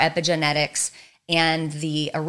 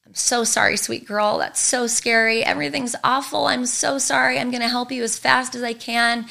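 soothing mother, raised pitch, tender; comforting mom; 6 semitones higher than usual. Retold or imitated. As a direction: comfort a scared little girl gently. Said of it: "my mom goes".

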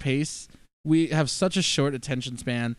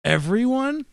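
mock excited villain; 6 semitones higher, 5 dB louder than usual. Mock movie character line.

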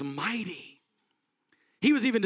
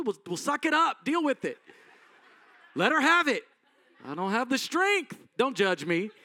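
higher, laughing, worn-out parent giving in; exhausted parent; 6 semitones higher than usual. Retold or imitated. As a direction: laugh through it, you've given up. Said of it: "you go".

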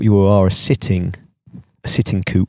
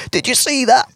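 much higher, hammed-up excited announcer; mock commentator. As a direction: play a giddy surf cam commentator. Mock surf-cam commentator voice.

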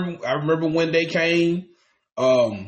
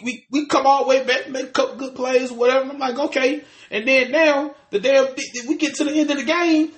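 rising, higher and higher in frustration; venting, voice climbing higher and sharper; pissed off rant; 8 semitones higher than usual. Real, not acted. Fan ranting about the Falcons' late collapse, angry climbing voice. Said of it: "first of all, I'm so pissed".

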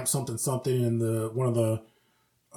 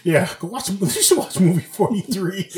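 usual speaking voice

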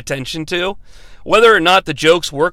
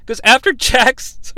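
ordinary talk, nothing marked

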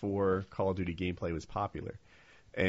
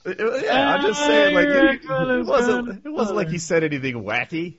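loud protest; 13 semitones higher, 10 dB louder than usual. Real, not acted. Defending his joke to co-hosts, loud high-pitched protest. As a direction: argue back well above your usual pitch.

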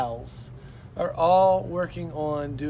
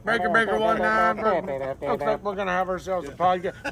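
over walkie talkie. Pretend. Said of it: "just gonna be C Bs".

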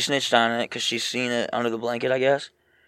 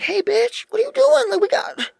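much higher cat voice. Retold or imitated. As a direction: squeak it like a bossy cat.

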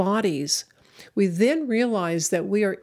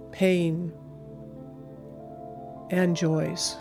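guided meditation. Acted. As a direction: guide them gently, long pauses. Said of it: "take you through a meditation".

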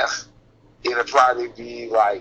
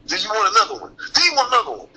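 climbing higher each time; pushy high plea; 7 semitones higher, faster than usual. Retold or imitated. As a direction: press him harder, higher. Same question rising louder.

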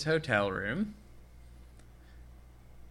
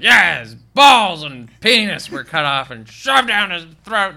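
mock loud telling; 4 semitones higher, 11 dB louder than usual. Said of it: "he's like".

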